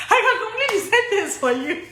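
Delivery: high, laughing through the boast; real amused laughter; laughing proudly. Real, not acted. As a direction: chuckle as you say it. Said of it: "it's expected".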